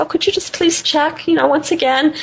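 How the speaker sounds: high-pitched, coaxing plea; sweet pleading. Retold or imitated. Guest retelling how she pressed the innkeeper, high pleading. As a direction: beg nicely, voice lifted high.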